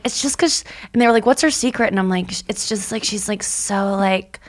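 breezy and laid-back, like nothing matters; impression of cohost. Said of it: "That really sounds like your voice".

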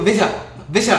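mock garbled "Vince Young", consonants swallowed; imitating slurred name. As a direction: blurt "Vince Young" twice as one blurred word.

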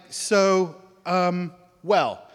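halting, awkward openers; re-enacted stunned hesitation. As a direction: act out groping for how to begin. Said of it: "like, kind of stunned".

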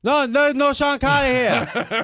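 high, slow put-on voices; mock phone call. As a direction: drag it out like a confused worker. Prank call dialogue acted out.

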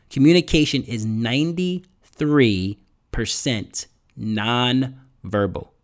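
slowly spaced, word by word; slow and emphatic. Real, not acted. Coach repeats the key figure for listeners, slow and emphatic. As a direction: spell it out slowly, pausing between phrases.

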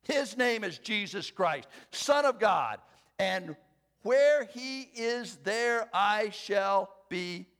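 quoting Luther with thunder; fiery preaching. Acted. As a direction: defy the devil out loud. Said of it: "And then he said".

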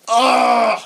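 loud, exasperated "ugh"; upset groan. Retold or imitated. Comic retelling of losing his omelet, exasperated groan. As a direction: let out a big frustrated groan. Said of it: "I was so upset".

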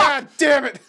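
high, loud mock tantrum; child rage. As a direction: erupt like a furious kid. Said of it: "It was my child rage of".

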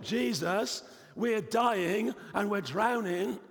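high, loud, slow plea of frightened men; acted panic, pitched high and loud, dragged out; panicked cry. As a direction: cry out to Jesus as if the boat is sinking. Panicking disciples in the storm.

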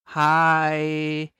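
usual speaking voice

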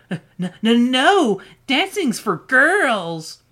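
stammered start, then high drawn-out protest; stuttering. Acted. Eddie protesting dancing after bumping his head, stuttering. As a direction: trip on the first word, then whine it slowly. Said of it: "He rubbed his head and stuttered".

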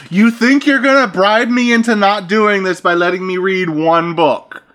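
high, loud disbelieving voice; mock outrage at the bribe; incredulous scoff. A kid scoffing at a bribe, high loud disbelief. Voicing her face's thought.

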